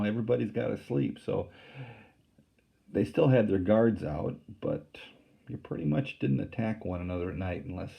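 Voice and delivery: ordinary delivery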